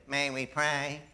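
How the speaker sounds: soft, nasally little preacher; hushed nasal impression of a preacher; imitated nasally voice. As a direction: mimic a small preacher's nasal voice, quietly. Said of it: "in this high, nasally voice".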